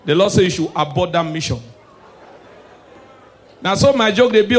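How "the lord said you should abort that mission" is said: normal talking voice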